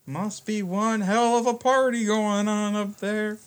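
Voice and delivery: high, slow knowing barkeep; bartender voice. Acted. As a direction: drawl it out with a wink. Bartender NPC comments.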